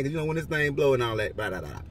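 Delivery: ordinary talk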